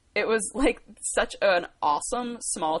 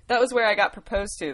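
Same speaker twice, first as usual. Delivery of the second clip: ordinary talk, nothing marked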